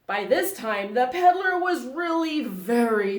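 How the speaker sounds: loud, mounting narration; building anger. A narrator's reading. Narrator to children as the peddler stamps, building anger. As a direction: build steadily toward a shout.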